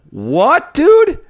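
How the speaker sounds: high, loud disbelieving dude; a sudden shocked yelp; outraged dude. Real, not acted. Outraged rhetorical question.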